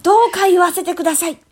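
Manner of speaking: high, fast campaign plea; rally speech. Pretend. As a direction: beg the crowd like a candidate. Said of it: "広報したいですもんね". (街頭演説風の高い声)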